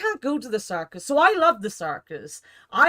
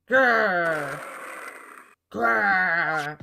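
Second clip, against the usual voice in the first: tiger growl; 4 semitones lower than usual. Acted.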